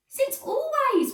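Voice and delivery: high, slow wail; slow, high wounded wail. A narrator's reading. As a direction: drag it out like a hurt whine. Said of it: "wailed Susan".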